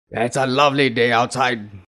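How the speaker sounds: demonstrated anger, forced through the whole line; angry. Shown as a demonstration. Teacher showing students how tone conveys emotion, angry. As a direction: say it as if cross about it. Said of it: "angry about it".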